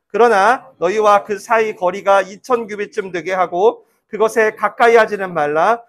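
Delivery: 회중과 함께 읽는 힘찬 낭독; 또박또박 힘주어 성경 본문을 읽기. Acted. (예배에서 다 같이 성경 봉독)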